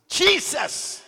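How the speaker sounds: Jesus shouted very high and loud; with attitude. Shown as a demonstration. Pastor showing the church how to shout Jesus with attitude. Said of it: "add an attitude to it".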